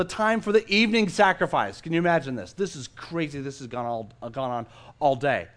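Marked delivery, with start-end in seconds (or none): none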